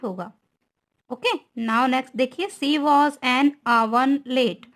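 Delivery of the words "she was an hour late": reads the sentence very slowly, word by word; slow dictation. Shown as a demonstration. Teacher dictating an example sentence to students, very slow. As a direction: spell it out slowly for the class. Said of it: "teacher reads example sentence slowly".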